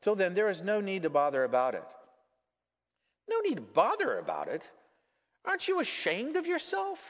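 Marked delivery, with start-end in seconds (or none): 3.3-4.6 indignant echo with swooping pitch; incredulous echo. Acted. Ghost echoes it in disbelief. 5.4-7.0 high-pitched indignant reproach; outraged scolding. Acted. Ghost scolds the murderer.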